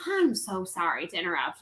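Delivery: lightly voiced older Southern man, low and soft; a hint of a polite Southern gentleman; good old Southern boy. Stranger approaching her at a sports bar, lightly imitated Southern boy. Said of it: "It's a good old Southern boy".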